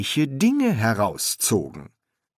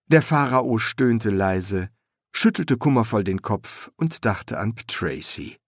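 ordinary delivery

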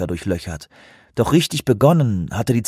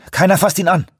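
commanding shout; 8 semitones higher, 3 dB louder, faster than usual. Acted. Officer commanding crew.

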